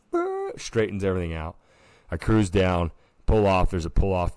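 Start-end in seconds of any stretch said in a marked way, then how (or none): 0.1-0.5 high buzzing brr; a short, high mechanical buzz; brakes locking noise. Retold or imitated. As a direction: mimic trailer brakes grabbing. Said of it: "It's like".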